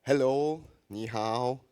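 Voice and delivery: ordinary delivery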